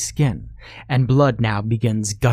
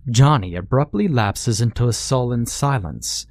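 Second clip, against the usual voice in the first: ordinary delivery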